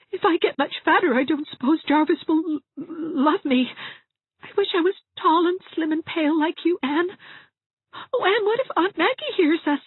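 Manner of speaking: very high, weepy, stammering voice; tearful Dovey, sobbing. A narrator's reading. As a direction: wail your worries through sobs.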